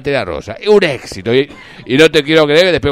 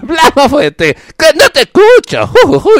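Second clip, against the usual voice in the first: very high, loud cartoon laugh; Larguirucho laugh; 13 semitones higher, 8 dB louder, much slower than usual. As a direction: shriek a cartoon hoot. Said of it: "se escuchaba un ju".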